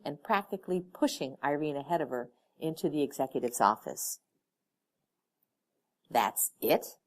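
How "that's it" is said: a doubtful, questioning "That's it?"; suspicious. A narrator's reading. Irene questioning her boss, mildly suspicious. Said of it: "Irene asked suspiciously".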